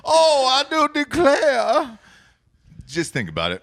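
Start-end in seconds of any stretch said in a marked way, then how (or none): 0.0-2.0 slow, high Southern belle "I do declare"; mock Southern belle. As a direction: draw it out like a fainting Southern lady. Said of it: "can you do a Southern accent".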